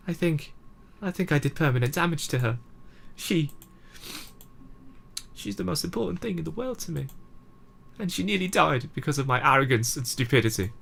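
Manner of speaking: high, tearful voice breaking with guilt; crying. Acted. Club leader tearfully confessing the harm she did, crying. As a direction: choke out the confession through tears. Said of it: "I don't normally cry like this".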